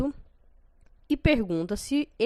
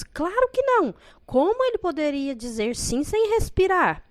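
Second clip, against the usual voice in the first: sharp, much higher and louder outburst; ranting; 9 semitones higher, 4 dB louder than usual. A narrator's reading. Marcela scoffing at Josefa's claim, high angry rant. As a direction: blast the idea down in fury.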